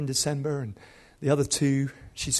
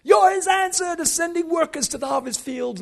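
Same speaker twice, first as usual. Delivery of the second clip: imitated rousing preacher; 12 semitones higher, 6 dB louder than usual. Dad preaching to a missionary kid, shouted exhortation. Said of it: "hearing my dad and many others preaching".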